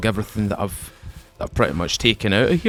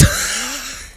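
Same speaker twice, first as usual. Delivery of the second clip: nervous high laugh. Real, not acted. After the narrow escape.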